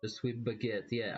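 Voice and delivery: ordinary delivery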